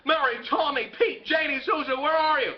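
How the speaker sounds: acted desperate search; frantic calling. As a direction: call out names in mounting alarm.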